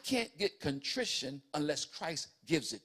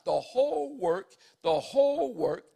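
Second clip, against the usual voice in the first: the whole work, the whole work, lifted higher and louder; emphatic repetition; 6 semitones higher, 6 dB louder, slower than usual. Acted. Preacher stressing the whole work is the Lord's, emphatic. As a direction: hammer the whole work twice.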